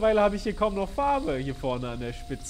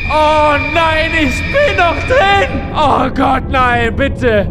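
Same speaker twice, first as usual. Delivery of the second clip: panicked cries; 6 semitones higher, 9 dB louder, much slower than usual. Real, not acted. Trapped, panicking in game.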